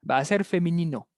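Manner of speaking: ordinary delivery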